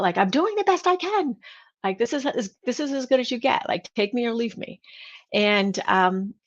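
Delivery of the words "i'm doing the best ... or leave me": re-enacted high-pitched yell without real volume; mock frustrated yell. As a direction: act out a defensive, exasperated outburst, pitched up. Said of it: "I yelled out".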